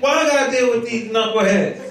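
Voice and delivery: high, comic exasperated rant; hammed-up griping as a fed-up Moses; complaining. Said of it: "This is how he's talking".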